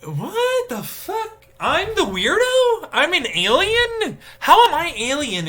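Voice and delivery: a hammed-up high wail of disbelief; incredulous falsetto. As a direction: squeal it, offended at being called weird. Mock indignant self-quote.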